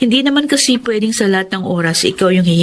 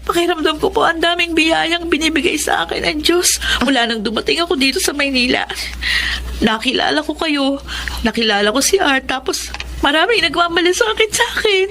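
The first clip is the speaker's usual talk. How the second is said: crying; 6 semitones higher than usual. Acted. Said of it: "Eh, bakit ka umiiyak?".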